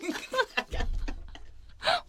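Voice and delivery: airy high-pitched laugh; a breathy giggle rising up; wife laughing at her spooked husband, airy and high. Real, not acted.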